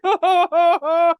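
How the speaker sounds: high, loud-ish panicky moans with laughter; mock dread. Fan mimicking a character's reaction to the villain, mock dread. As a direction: imitate someone freaking out, half laughing.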